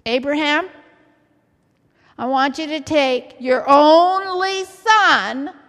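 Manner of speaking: loud, commanding divine call; voice of God. Acted. Preacher as God summoning Abraham, loud solemn command. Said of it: "God came down and said".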